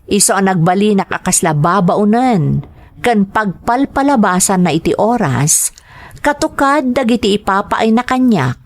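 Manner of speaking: story narration. A narrator's reading. Woman recalling her marriage to Arman, narrated evenly. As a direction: tell her story looking back, evenly.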